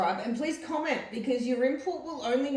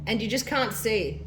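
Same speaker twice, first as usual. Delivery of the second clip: diary reading; 4 dB louder than usual. Acted. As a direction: read the diary entry out with feeling. Reads mum's diary aloud.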